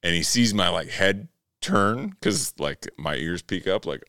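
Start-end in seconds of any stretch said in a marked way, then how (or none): none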